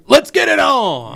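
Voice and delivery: high, loud, drawn-out rallying cry; hype shout. Acted. Host reads a viewer's comment to the gang, rousing shout. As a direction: belt it out like a hype call.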